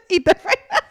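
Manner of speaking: loud laughing shout; cracking up while joking. Real, not acted. Laughing at mic joke.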